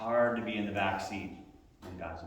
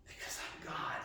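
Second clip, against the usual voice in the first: hushed, breathy whisper of God's claim; whispered as God speaking; 12 dB quieter than usual. Acted. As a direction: drop to a bare whisper. God's words whispered in sermon.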